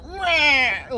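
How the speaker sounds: high, loud mocking "rah"; mock angry roar. Player mimics the guy yelling at a grieving sister, mock roar. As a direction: imitate him blowing up with one wordless blare. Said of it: "this guy's like".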